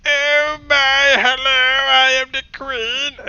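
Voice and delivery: put-on an old man faking a dainty queen; mock queen falsetto. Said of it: "same crappy old man voice".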